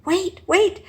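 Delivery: loud, high urgent call. Acted. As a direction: call out to stop them. Bat pushing through crowd calling.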